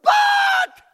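but shouted high and loud. Shown as a demonstration. Preacher shouting the word but at his congregation. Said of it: "circle that word".

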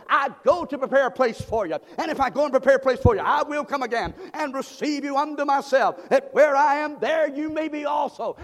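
loud, high whooping sermon; full-force preaching. Real, not acted. Pastor preaching heaven's promise, loud and high. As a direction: preach with rising fervor.

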